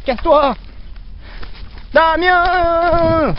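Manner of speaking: high, loud panicked pleading; scared horror game streamer. Acted. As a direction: beg and scream in terror. Ghost clown is there, pleading.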